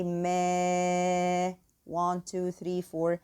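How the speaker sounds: ما held slowly for four counts; slow counted elongation; four-count prolonging. Shown as a demonstration. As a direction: stretch ما and count the beats. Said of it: "prolonging for four counts".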